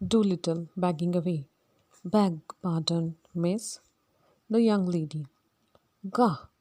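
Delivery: plain reading voice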